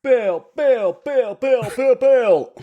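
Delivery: high, goofy word-noises; silly repeated bill. Pretend. Podcaster playing with a word for radio, high and silly. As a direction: pitch it up and repeat playfully.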